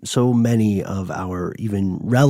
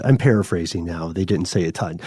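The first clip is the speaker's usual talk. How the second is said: usual speaking voice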